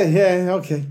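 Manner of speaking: half-laughed concession, tailing off; laughing acknowledgement. Acted. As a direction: give the joke a light, grudging nod. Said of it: "it's the combination of a laugh and like".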